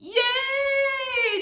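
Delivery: high, drawn-out cheer; excited cheer. Real, not acted. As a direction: celebrate the song's end. Cheering at end of song.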